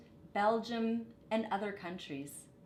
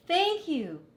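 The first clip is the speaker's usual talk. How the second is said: higher, louder heartfelt "Thank you"; grateful thanks; 10 semitones higher, 8 dB louder than usual. A narrator's reading. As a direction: thank them gladly and brightly. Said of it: "said the villagers".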